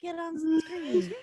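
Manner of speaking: high, slow coaxing; coaxing pet voice. Real, not acted. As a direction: coax the cat gently. Coaxing the cat.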